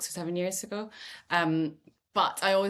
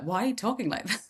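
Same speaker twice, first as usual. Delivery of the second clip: higher, quicker imitation of a puzzled parent; mock a parent's baffled question; 5 semitones higher, faster than usual. Parents questioning their child's new English accent, puzzled imitation. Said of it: "my parents would pick me up".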